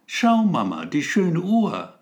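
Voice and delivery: put-on a small boy marvelling at something; child voice. A narrator's reading. Child points out the clock.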